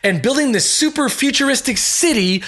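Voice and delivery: livid caller. Retold or imitated.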